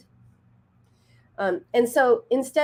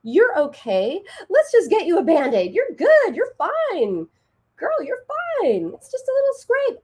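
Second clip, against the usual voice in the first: very high, loud cheery brushing-off; dismissively positive; 10 semitones higher, 3 dB louder than usual. Retold or imitated. Mom brushing off crying daughter's scrape, over-positive. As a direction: play down a child's pain in a sing-song high voice. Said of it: "I was positive. I said".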